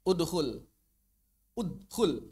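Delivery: udkhul with the d bounced out; qalqalah drill. Shown as a demonstration. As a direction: udkhul with an echoing d. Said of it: "qalqalah drill".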